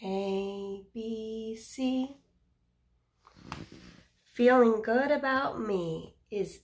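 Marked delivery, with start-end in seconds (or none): 4.3-6.6 loud, bright picture-book read; reading to children. Acted. Teacher reads the book aloud to children. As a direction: read it out big for the kids.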